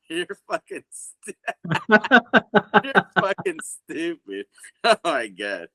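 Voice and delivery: words squeezed out through laughter; laughing hard. Real, not acted. Friend laughing at the other host's joke, through laughter. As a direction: laugh helplessly while calling your friend stupid.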